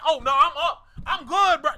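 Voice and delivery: high, loud burst of gloating; mock excited. Playing a man thrilled by a DM, mock excited. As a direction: crow it as a hammed-up winner. Acts out delight at getting DM.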